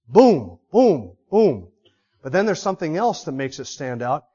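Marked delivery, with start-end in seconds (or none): 0.1-1.7 gavel booms. Shown as a demonstration. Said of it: "You hear that?".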